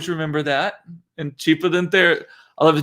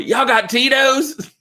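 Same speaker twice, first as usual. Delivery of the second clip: high, drawn-out party holler; Karen Kelly; 10 semitones higher, slower than usual. Retold or imitated. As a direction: imitate a friend arriving at a party, pitched way up and stretched out. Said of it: "my friend Karen Kelly used to always come into a party".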